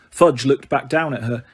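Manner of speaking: plain reading voice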